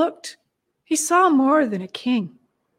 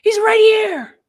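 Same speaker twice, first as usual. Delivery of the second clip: high, raised excited cry; crowd crying out; 8 semitones higher, 4 dB louder than usual. A narrator's reading. Crowd spreading word that he's here, crying out. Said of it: "he heard the crowd crying out".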